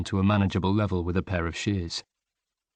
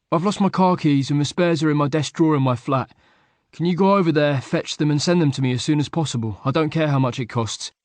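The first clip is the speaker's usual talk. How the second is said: louder, pushy urgent request; urgent demanding; 6 semitones higher, 5 dB louder than usual. A narrator's reading. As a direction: get the keys sent now. Luke voiced demanding keys.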